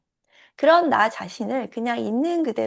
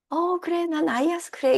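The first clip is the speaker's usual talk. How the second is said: cheery agreement; 8 semitones higher, slower than usual. Pretend. Cheerful acceptance, contrasted with hurt.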